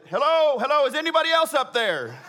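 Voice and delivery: high, loud hammed-up call; loud yell. Man on the branch seeks a second opinion, comic loud yell. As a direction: yell up for someone else. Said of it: "loud yell. He said".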